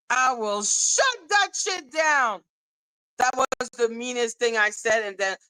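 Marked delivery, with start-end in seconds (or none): 0.1-2.4 higher, slow, drawn-out mock threat; retold stern warning. Quoting the meanest thing she said, as a stern retold warning. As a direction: lay down the law, drawing the words out. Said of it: "To just hear me say".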